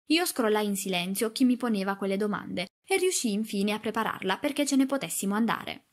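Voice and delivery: ordinary delivery, nothing marked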